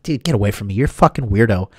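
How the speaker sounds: under his breath. Retold or imitated. I kept my mouth shut.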